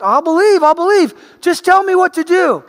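high, loud, frantic begging; desperate pleading. Retold or imitated. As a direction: beg for your life. Desperate man pleads with God.

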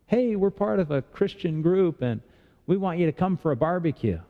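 high-pitched, overly friendly invitation; eager campus recruiter. Acted. Group recruiter inviting a student to a barbecue, eager and high.

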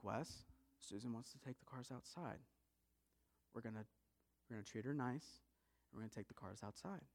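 low, hushed parental voice; gentle instruction. Acted. Mom telling Wes to treat cousin nicely, gentle instruction. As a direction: speak softly and low, settling the matter.

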